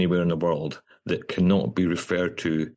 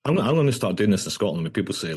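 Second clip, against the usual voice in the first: ordinary delivery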